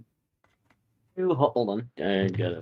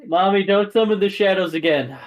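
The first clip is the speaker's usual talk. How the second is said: put-on high, raised little-kid plea; pleading child; 7 semitones higher, 4 dB louder than usual. Child begging mother over shadows, pleading voice. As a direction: plead like a small child to mommy.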